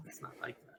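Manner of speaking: whispered aside. Real, not acted. Tacking on a whispered aside after the rant, whispered. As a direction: drop to a whisper to close the point.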